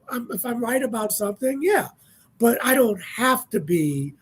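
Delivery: normal talking voice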